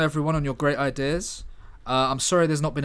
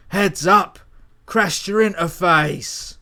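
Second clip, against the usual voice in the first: loud, high battle yell, slowed on the end; screaming; 5 semitones higher, 9 dB louder, slower than usual. Acted. Coda screams at the men as he smashes their heads together. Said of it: "as he screams".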